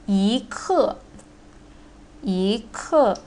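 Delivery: Yi ke with Yi rising; yi ke, second tone yi. Shown as a demonstration. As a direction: Yi rises before falling ke. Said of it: "yi need to change into the second tone".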